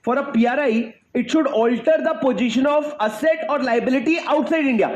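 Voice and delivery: very slow, each word spelled out for the class; measured, deliberate recital of a law text; slow recited definition. Shown as a demonstration. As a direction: dictate it slowly like a rule to memorise. Teacher reciting the legal definition.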